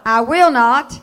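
loud, ringing declaration for the church to echo; emphatic call to repeat. Shown as a demonstration. Preacher leading the church in a loud declaration. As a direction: lead the congregation, each word hammered. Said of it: "say this with me".

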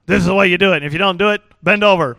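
loud, brisk ultimatum; stern father. Retold or imitated. Father ordering his kids to bend over, stern and loud. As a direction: lay down the law, loudly. Strict father before a spanking.

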